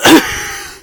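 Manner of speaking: a sharp chesty cough; wheezy cough. A narrator's reading. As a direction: cough it out like a sick engine. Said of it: "Henry wheezed".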